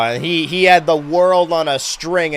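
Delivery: normal talking voice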